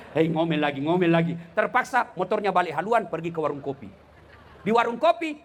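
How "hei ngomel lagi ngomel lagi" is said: imagined grumbling, building. Acted. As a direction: repeat it with growing weight, as a fed-up husband. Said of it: "tiba-tiba dia bayangkan". (gerutuan suami yang makin keras)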